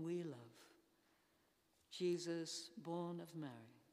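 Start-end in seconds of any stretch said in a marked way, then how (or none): none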